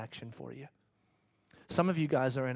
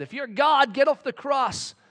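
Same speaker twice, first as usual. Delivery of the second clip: louder, higher taunt; mocking soldiers; 11 semitones higher, 9 dB louder than usual. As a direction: jeer at the man on the cross. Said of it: "the soldiers said".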